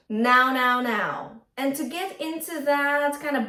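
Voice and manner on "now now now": "now" held forward on a bright N; bright nasal now. Shown as a demonstration. Coach demonstrating forward nasal placement. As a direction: place "now" in the nose with a buzzing N. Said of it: "let's think about an N kind of sound".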